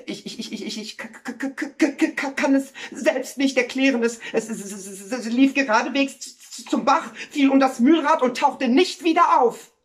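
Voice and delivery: high, frantic stammering; agitated stutter. Acted. Neighbour explains how the dog drowned, agitated and stuttering. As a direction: stutter on first sounds, building to a loud finish.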